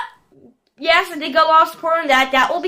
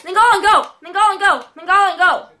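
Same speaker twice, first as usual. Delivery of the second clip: raised, quick repeated cheer; excited urging; 4 semitones higher, faster than usual. Real, not acted. Player urges Nainggolan on, excited calls. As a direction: urge him forward, easing slightly each time.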